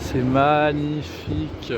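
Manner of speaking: slow, breathless wonder on magnifique; awed, drawn out. Real, not acted. Traveller marvelling at the bridge view, drawn out slowly. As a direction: stretch out magnifique slowly in awe.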